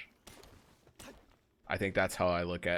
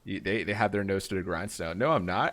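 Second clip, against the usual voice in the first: ordinary talk, nothing marked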